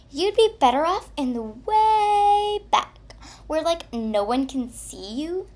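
sugary fake-sweet mean girl; fake nice voice. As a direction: sound sweet but mean it nastily. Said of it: "in a fake nice voice".

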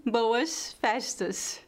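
slow Rio accent with hushed sh for each S; Carioca accent. Shown as a demonstration. Teacher imitating Rio speech for learners, Carioca accent. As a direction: say it like a Carioca, drawn out. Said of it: "é mais ou menos assim".

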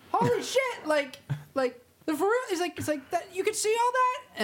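high, breathless awe; amazed. Acted. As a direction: gush like a gullible believer. Awed actor at fortune teller.

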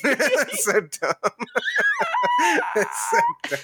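high squeal of laughter; laughing. Real, not acted. Laughing at own bit.

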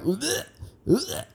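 throaty mock gagging; imitated retching. Storyteller showing how Charles pukes, mock retching. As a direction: heave out two short gags.

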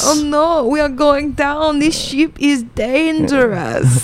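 botched German accent, drawn out in mock alarm; Tommy Wiseau. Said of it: "You sound like Tommy Wiseau".

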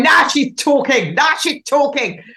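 put-on playful northern English accent, high and lively; mock Northern bloke cheering. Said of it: "did you like my northern accent there".

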